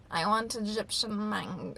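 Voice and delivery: slow, drawn-out odd voice; strange, slowed-down impression of an eccentric lady; crazy lady. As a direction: imitate a weird woman, stretching the words. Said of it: "the way she spoke, she was like".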